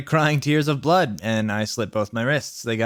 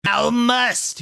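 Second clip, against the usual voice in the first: much higher mock yell; yelled villain command; 11 semitones higher than usual. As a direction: bark the order like a hammy villain. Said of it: "he yelled".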